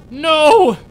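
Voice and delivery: high, loud scream of no; anguished scream. Acted. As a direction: scream it in despair. Friend lost, outcry.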